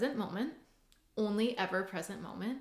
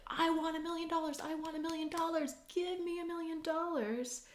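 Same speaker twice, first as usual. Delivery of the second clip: mock scream; 9 semitones higher than usual. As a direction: act out screaming at a genie, without real volume. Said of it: "you could be screaming at this genie".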